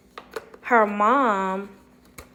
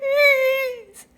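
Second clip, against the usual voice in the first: high, loud, drawn-out wail; hammed-up sobbing; mock crying; 7 dB louder than usual. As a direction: imitate a tearful woman's bawling plea. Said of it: "hugging this lady crying".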